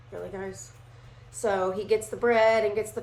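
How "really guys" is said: quiet, unimpressed "Really, guys?", falling; a muttered, tired sigh at the disciples; soft exasperated Jesus. Retold or imitated. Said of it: "And Jesus is like".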